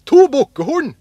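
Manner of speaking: high, very loud answer; excited announcement. A narrator's reading. Askeladden tells his brothers of two goat horns, thrilled. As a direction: burst out with the find.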